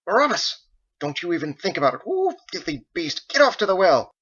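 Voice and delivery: high, loud motherly scolding. Acted. Margot scolds the beast away from the kitchen, loud and shrill. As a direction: shoo the filthy beast away.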